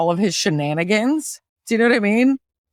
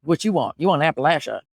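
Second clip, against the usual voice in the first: put-on Appalachian twang; Appalachian accent; faster than usual. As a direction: talk like an Appalachian local. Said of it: "this is more of an Appalachia".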